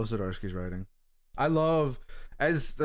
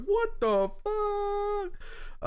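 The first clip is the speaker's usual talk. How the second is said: retold shock. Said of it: "I was like".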